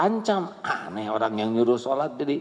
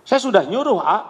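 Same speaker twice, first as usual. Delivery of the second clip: high, louder indignant protest; defensive protest; 10 semitones higher, 6 dB louder, faster than usual. Retold or imitated. Parent protesting to the preacher, in a high, louder indignant voice. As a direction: insist you already told them.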